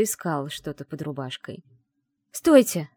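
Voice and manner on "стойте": loud urgent cry to stop him; exclaiming. Acted. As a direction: call out sharply, much louder. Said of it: "воскликнула я".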